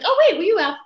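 high, loud startled double-take; sudden realization. Retold or imitated. Fan spotting green at stage door, surprised realization. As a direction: imitate a fan suddenly catching on.

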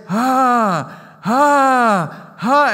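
high, loud strained "ah" sounds; girl's straining sounds. Retold or imitated. Preacher imitating the girl in the wheelchair, high strained calls. But she starts to say.

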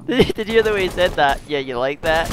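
high, loud laughing mockery; shrieking with laughter at a mate; laughing hard. Real, not acted. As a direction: crack up while teasing him. Mocking teammate, cracking up.

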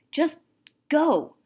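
snapping go at his brothers; urgent. Acted. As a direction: shoo them out, loud and pressing. Omri hurrying brothers out.

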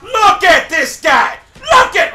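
high-pitched indignant yell; real frustrated outburst; outraged shout. Real, not acted. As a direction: yell in disbelief at an opponent. Player outraged at opponent.

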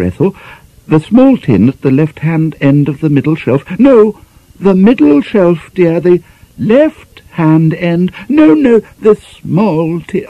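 high, fluttering, flustered lady's voice; dithering over the baking powder tin; fussy spinster mistress. Acted. Flustered mistress giving her maid orders.

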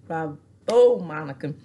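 booming applause; loud bravo. Real, not acted. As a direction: shout the bravo. Applauding Monica.

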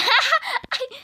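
high laugh. Real, not acted. Anne rolüne gülme.

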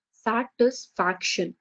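"satisfaction" said very slowly, its four syllables spaced out. Shown as a demonstration. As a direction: lay out each syllable of "satisfaction". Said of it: "Four syllable means".

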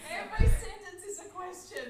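loud and high through laughter; laughing hard while she says it. Real, not acted. Australian listener joking about upspeak, laughing loudly.

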